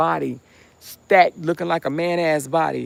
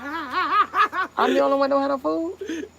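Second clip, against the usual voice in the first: laughing; 11 semitones higher than usual. Real, not acted. Laughing while complaining.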